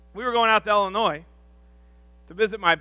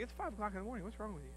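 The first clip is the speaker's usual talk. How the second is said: much softer, half-asleep wife; sleepy wife; 20 dB quieter, faster than usual. Retold or imitated. Wife asking her wired husband what's wrong, much softer. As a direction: mumble it like she's just woken up. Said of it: "my wife's like".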